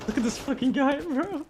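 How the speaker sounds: incredulous. Real, not acted. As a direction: sound amazed at how bad he is. Mocking a bad enemy player.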